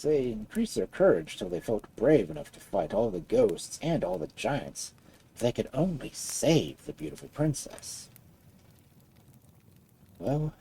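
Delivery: plain reading voice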